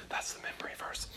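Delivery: a quick whisper under the reading; whispered aside. Real, not acted. Teacher whispering a reminder to students mid-reading, hushed. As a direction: drop to a whisper for a side note.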